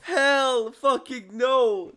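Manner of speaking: high, drawn-out refusal; emphatic refusal. Real, not acted. Podcaster refusing outright, high and slow emphatic refusal.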